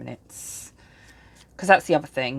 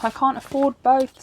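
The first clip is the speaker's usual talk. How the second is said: exasperated; 10 semitones higher, 6 dB louder than usual. Retold or imitated. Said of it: "I was like".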